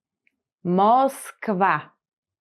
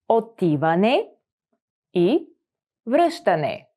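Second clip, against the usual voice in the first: Отиване, и, връщане, each set apart by pauses; word by word. Shown as a demonstration. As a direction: break Отиване и връщане into separate words. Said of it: "teacher splits phrase word by word".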